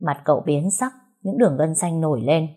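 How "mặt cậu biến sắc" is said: usual speaking voice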